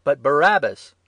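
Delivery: loud, high demand; crowd shouting. A narrator's reading. Crowd demanding Barabbas from Pilate, shouting. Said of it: "they shouted again".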